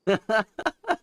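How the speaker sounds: a short high giggle. Real, not acted. Streamer laughing at her own self-roast, high giggle. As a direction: giggle at yourself.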